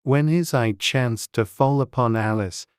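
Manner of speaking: usual speaking voice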